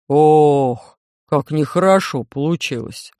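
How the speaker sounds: low, heavy, dismayed giant; low alien voice. A narrator's reading. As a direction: rumble it like a big clumsy alien.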